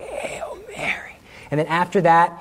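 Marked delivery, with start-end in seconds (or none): 0.0-1.2 slow, strained whisper; choked out. Retold or imitated. Choked man finally getting his prayer out, strained whisper. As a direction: force the words through a squeezed throat. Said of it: "I was able to get the word".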